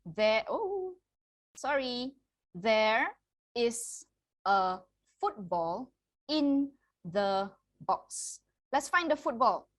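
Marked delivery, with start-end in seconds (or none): 2.5-8.4 slow reading demo. Shown as a demonstration. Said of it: "One more time".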